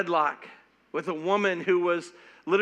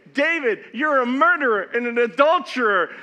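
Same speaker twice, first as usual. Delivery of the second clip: loud stern accusation; accusing; 9 semitones higher, 9 dB louder than usual. Retold or imitated. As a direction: call him out to his face. Prophet confronts the king.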